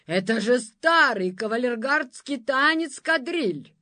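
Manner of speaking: loud, indignant exclamation; indignant outburst. Acted. Monster outraged at the old quadrille, loud indignation. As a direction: protest loudly at the dance.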